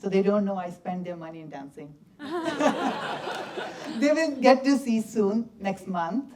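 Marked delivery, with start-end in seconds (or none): 2.2-3.9 bright burst of laughter. Real, not acted. Dancer laughing at his own joke to the audience.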